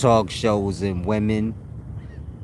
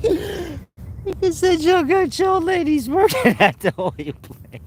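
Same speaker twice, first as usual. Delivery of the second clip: laughing; slower than usual. Real, not acted. Laughing through retelling.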